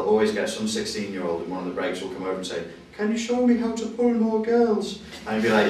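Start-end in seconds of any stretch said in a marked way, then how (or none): 3.0-5.0 put-on high, slow, drawn-out teenage voice; teenage schoolboy. Voices a sixteen-year-old student asking.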